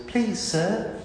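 put-on high, slow, small pleading child voice; timid little Oliver. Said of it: "and he goes".

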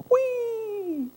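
very high, gleeful whee; joyful whee. Pretend. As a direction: let it fly like a carefree kid on a slide. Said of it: "being like".

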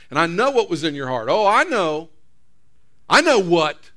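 acted devil's jeering accusation; accusing taunt. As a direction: taunt them as if you caught them out.

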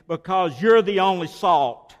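fiery preacher's climax; preaching at full force. Acted. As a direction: shout it to the congregation.

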